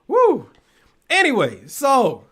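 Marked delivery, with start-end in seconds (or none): 0.1-0.5 sharp high woo, much louder; a loud high whoop of disbelief; woo exclaim. Real, not acted. Exclaiming about heartless ex.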